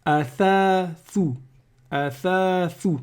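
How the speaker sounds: "athathu" drawn out, every th clear; very slow Arabic word. Shown as a demonstration. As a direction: spell out "athathu" syllable by syllable. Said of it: "Et ici".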